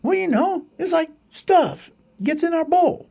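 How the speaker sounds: high bragging chick voice; boasting little bird. Acted. Pet bird explains salsa.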